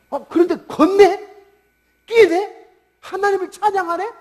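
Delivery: high, incredulous questions; loud rising disbelief, pitched high; astonished onlookers. Acted. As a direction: act out a crowd that can't believe its eyes. Crowd amazed at healed beggar.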